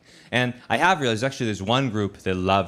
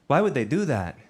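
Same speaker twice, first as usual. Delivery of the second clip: high, sweet mock sympathy; high syrupy counselor voice; concerned counselor; 6 semitones higher than usual. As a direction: fake caring counselor voice. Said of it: "She's like".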